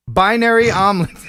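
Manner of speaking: laughing through the name. Real, not acted. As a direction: crack up mid-name. Rereads name, cracking up.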